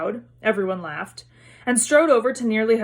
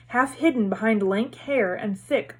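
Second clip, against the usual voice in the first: usual speaking voice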